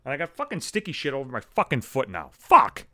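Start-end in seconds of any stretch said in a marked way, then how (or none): 2.3-2.9 loud, high burst of frustration; sudden exasperated yell; frustrated shouted curse. Real, not acted. Just stepped in a bowl.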